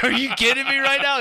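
high, loud, disbelieving cry of outrage; teen yelling at dad in total disbelief; shocked yelp. Retold or imitated. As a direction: shriek it like you can't believe he's serious. Teen shocked at dad's orphanage drop-off.

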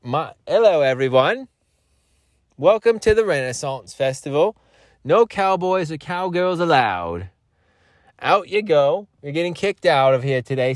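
high, posh-toned English greeter; English accent. Pretend. Festival greeter welcoming guests in a high English accent. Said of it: "talk in an English accent".

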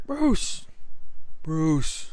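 high call from across the room; calling across a bar. Retold or imitated. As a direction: call his name over the crowd. James calling him across bar.

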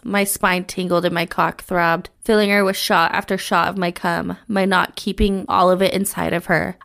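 usual speaking voice

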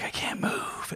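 barely breathed, voiceless and weak; a retold, hurt girlfriend whispering she can't move; faint whisper. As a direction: whisper it like you can hardly speak. Injured girlfriend after the crash.

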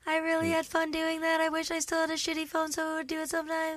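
high-pitched mock robot voice. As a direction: talk like a glitchy robot. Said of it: "now she's making a voice".

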